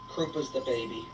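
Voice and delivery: ordinary talk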